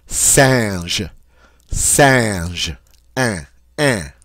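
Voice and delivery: French "singe", then nasal "an" alone; French nasal vowel. Shown as a demonstration. Teacher gives French word for monkey, nasal vowel. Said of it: "As in the French word for monkey".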